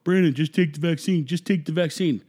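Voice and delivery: lightly hammed-up nag; nagging voice. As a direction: parrot the people nagging him. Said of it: "some people out there that are saying".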